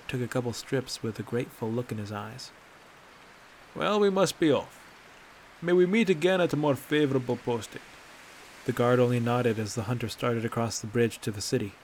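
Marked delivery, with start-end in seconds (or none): 3.7-7.8 higher, jovial parting wish; cheery farewell. Acted. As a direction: wave off with a flourish. Bracknell taking leave.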